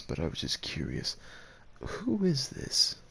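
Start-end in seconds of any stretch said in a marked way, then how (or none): 1.8-3.0 drawn out and much higher, slow; suspicious surprise. Acted. Asking about the person in the photograph, slow and suspicious. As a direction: stretch the question out in jealous disbelief.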